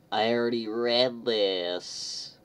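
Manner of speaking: slow, loud, high outburst; dismayed realisation. Real, not acted. Reader finding he has read this chapter before, dismayed. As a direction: draw it out loudly as it dawns on you.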